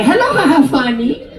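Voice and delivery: put-on high, sing-out greeting in Chinese accent; hammed-up Chinese accent, pitched high; imitated Chinese accent. Nail lady hailing her regular, imitated Chinese accent. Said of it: "That's her accent".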